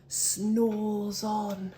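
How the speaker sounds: ordinary delivery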